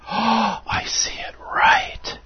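gasp, then high, airy whispered wonder; awed realization. Acted. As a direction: breathe it out slowly in amazement. Acting out sudden sight of miracle.

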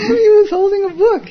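laughing so hard the voice climbs high; cracking up at the image, words squeezed out through laughter. Real, not acted. Laughing at his own story of the man with the book.

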